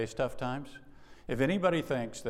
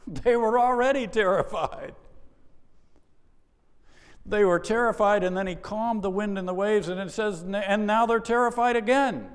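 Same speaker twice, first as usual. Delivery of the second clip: much higher and louder, hammering the word terrified; excited preaching shout; 10 semitones higher, 8 dB louder than usual. Acted. Preacher telling his congregation the disciples were terrified again, in a loud, high, excited voice. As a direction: preach it out loud, pressing the disciples' terror on the room.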